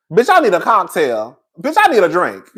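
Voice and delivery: ordinary talk, nothing marked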